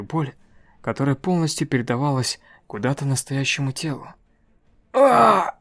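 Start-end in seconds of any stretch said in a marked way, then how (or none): 4.9-5.5 loud high scream of agony; full-throated scream. Acted. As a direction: scream with all your breath. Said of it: "закричала во весь дух статуя".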